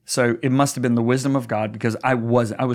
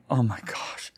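whispered dismay; 7 dB quieter, slower than usual. Retold or imitated. Retold heavy distress in airport.

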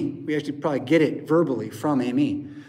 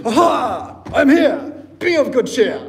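loud, high, hammy heroic British cry; 7 semitones higher, 7 dB louder than usual. Retold or imitated. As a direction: swing in like Errol Flynn bellowing cheer. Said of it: "a little British".

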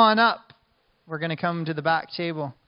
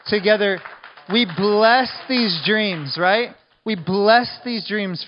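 blessing; 4 semitones higher, 6 dB louder, slower than usual. Real, not acted. Leads blessing over the room.

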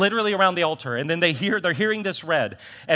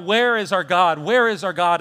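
crying out; 4 semitones higher, 8 dB louder than usual. Retold or imitated. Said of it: "They're crying out".

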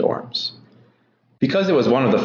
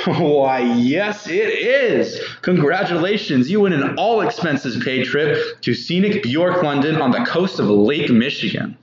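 booming prize announcer; 3 semitones higher than usual. Acted. Host announces the winner.

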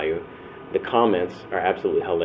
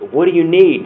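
eager offer; 9 semitones higher, 8 dB louder than usual. Retold or imitated.

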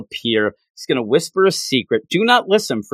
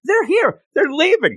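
bewildered; 12 semitones higher than usual. Retold or imitated. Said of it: "He doesn't know what's going on".

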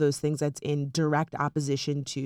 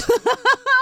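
high, loud burst of laughter; 7 dB louder than usual. Real, not acted. Host reacting to co-host's seance joke, loud laughter. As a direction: burst out laughing, pitch shooting up.

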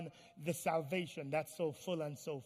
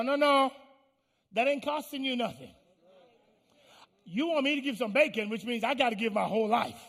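acted outburst, raised pitch and volume; loud indignant protest; 4 semitones higher, 8 dB louder than usual. Pig objects to chicken's breakfast deal, in loud mock indignation. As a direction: protest like the one paying the whole price.

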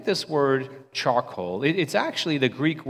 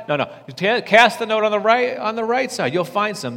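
higher, louder call; mock insistent call; 9 semitones higher, 5 dB louder than usual. Disciples voiced calling to Jesus, mock insistent and higher. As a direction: insist on the right side.

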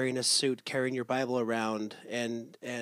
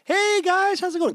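much higher and louder, sing-song parent greeting; cheery parent voice; 7 dB louder than usual. Retold or imitated. Parent greeting the kids with a bright high voice. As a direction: chirp it like a friendly mom to the kids. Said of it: "I code switch as a parent".

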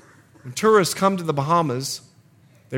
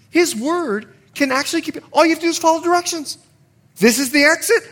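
preaching at full force; 6 semitones higher, 7 dB louder than usual. Acted. Sermon climax.

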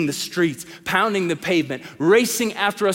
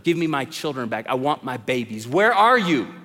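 normal talking voice

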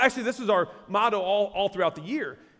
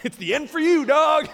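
"It's the end for you, dog." thrown out loud and higher, jokingly cold; mock heartless; 6 semitones higher, 6 dB louder than usual. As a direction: ham up a blunt, heartless farewell. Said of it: "That seems really heartless".

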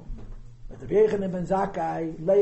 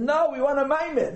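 pitched-up, insistent "we want"; voicing the eager Chassidim, higher; eager demand; 9 semitones higher, 4 dB louder than usual.

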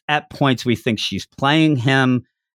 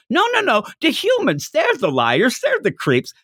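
mock quarrel; 13 semitones higher than usual. Power arguing back.

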